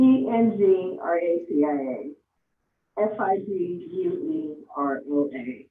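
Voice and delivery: slow letter-by-letter spelling; one letter at a time, slowly; spelled out. Real, not acted. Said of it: "maybe I should spell that".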